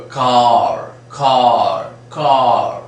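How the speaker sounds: car with no r, long open ah; non-rhotic car. Shown as a demonstration. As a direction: say car without sounding the r. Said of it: "We don't sound that r".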